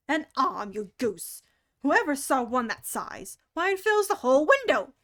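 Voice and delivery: loud exasperated scolding; scolding rabbit. Acted. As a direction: scold a fool, rising.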